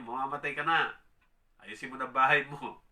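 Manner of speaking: loud, blunt prophetic warning; prophet's pronouncement. Retold or imitated. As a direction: deliver it loudly like a prophet bearing grim news. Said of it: "Sabi sa kanya". (malakas na babala ng propeta)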